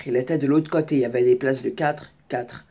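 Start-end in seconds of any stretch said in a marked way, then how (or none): none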